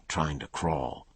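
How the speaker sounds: plain reading voice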